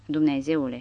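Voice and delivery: ordinary delivery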